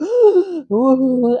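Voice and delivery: a loud sobbing gasp; gasp while crying. Retold or imitated. Telling how she cried to her mom, sobbing gasp. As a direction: gasp in through tears. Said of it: "I was just crying so much".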